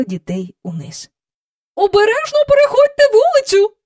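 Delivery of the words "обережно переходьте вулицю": high, loud call after them; mother calling after children at the gate. A narrator's reading. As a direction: call out across the yard. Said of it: "гукнула вона".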